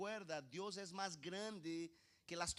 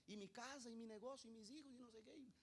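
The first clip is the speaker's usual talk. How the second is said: higher-pitched mock fretting, soft; imitated worrier, high and soft; worried fretting; 4 semitones higher, 9 dB quieter, faster than usual. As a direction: fret in a list like an anxious immigrant. Voices worried people listing possessions.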